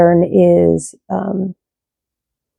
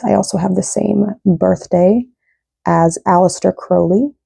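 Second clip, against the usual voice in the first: usual speaking voice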